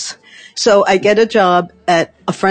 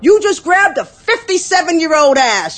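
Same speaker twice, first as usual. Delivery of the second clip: high, slow yell up the block; yelling at him; 6 semitones higher, slower than usual. Retold or imitated. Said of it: "I just yelled".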